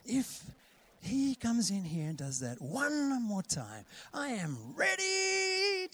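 drawn out, climbing to a high strained finish; hammed-up seething, building to a high cry; mock fed-up threatening voice. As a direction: play someone at the end of their patience, slowly rising. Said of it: "another voice could be that".

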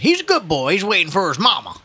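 put-on high old-timer's protest; old man. Old man defending Ignatius to the policeman, protesting. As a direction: stick up for the boy in an old man's voice. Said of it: "an old man added".

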